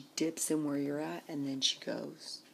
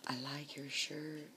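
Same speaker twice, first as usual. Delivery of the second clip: put-on quoting the child; 9 dB quieter than usual. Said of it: "precocious child".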